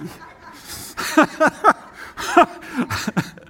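long, high, loud laugh; helpless giggling fit at oneself; real laughter. Real, not acted. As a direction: crack up laughing. Cracks up at own accent.

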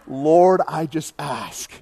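imitated prayer, loud drawn-out opening; mock-intense pastor praying; fervent prayer. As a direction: cry out the first word, then slow down and soften. Acts out David's intense prayer.

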